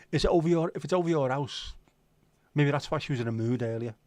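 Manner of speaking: ordinary talk, nothing marked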